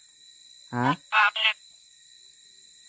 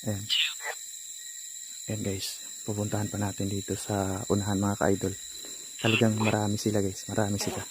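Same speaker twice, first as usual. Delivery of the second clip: hushed aside; 6 semitones lower, 9 dB quieter than usual. Real, not acted. Hushed aside to viewers while ghost-hunting.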